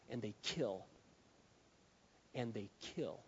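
low, soft and grave; grave hush. Real, not acted. Preacher warning congregation that words kill, gravely hushed. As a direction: drop right down after the outburst. Sudden hush after the shouting.

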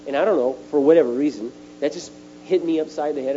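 ordinary delivery

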